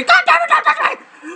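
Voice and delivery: high shrill shriek; shrieking girlfriend. Retold or imitated. Tickled girlfriend shrieks at him. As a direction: shriek it breathlessly.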